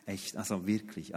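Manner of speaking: low, soft self-pitying murmur. Retold or imitated. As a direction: feel sorry for yourself. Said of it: "dann kommt meine Seele hervor".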